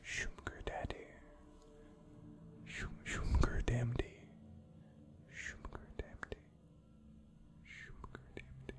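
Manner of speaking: whisper. Pretend. Teasing whispers of the name.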